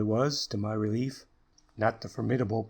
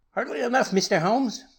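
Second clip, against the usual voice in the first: put-on shrill scream of a furious old man, much higher and louder; 13 semitones higher, 7 dB louder than usual. A narrator's reading. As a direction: scream it at him in sudden malignant fury. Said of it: "cried in a high screaming voice".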